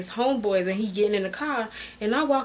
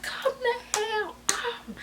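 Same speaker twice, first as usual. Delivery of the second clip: high, softer, drawn-out exasperated plea; mad, exasperated; 13 semitones higher, 8 dB quieter, much slower than usual. Retold or imitated. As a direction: stretch it out in high-pitched exasperation. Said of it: "I'm cussing him out 'cause I'm mad".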